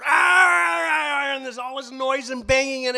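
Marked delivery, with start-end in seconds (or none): 0.0-1.4 very high, loud cries; screaming hyena. Acted. Hyena screaming in the cave, very high and loud. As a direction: scream out the offstage attack. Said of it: "you hear".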